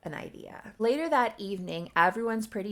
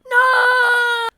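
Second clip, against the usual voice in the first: anguished scream, much higher and louder; 17 dB louder than usual. Acted. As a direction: cry out over the body. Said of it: "Nina screamed".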